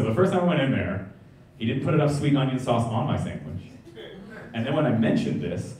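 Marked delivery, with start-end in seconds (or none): none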